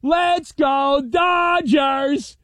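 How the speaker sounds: put-on high, slow drawn-out kid chant; cute little kid voice. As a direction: chant it like an eight-year-old at the ballpark. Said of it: "with his cute little kid voice is going".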